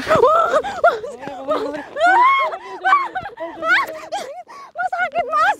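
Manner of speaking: high, pinched cry of hurt; yelp of pain. Acted. Ghost hunter cries out to his friend after his foot is stepped on, high pained yelp. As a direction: squeal as your foot gets stomped.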